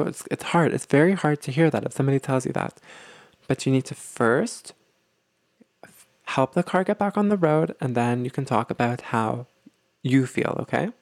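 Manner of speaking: usual speaking voice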